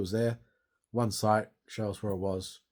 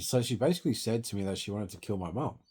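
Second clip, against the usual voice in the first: ordinary talk, nothing marked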